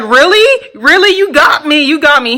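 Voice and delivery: high rising "really?" easing into a rueful laugh; stunned disbelief. Retold or imitated. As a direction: caught out, half shocked, half amused. Ex realizes he was catfished.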